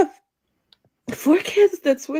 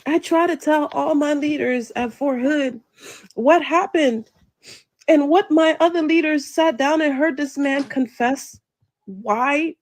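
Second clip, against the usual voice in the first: ordinary talk, nothing marked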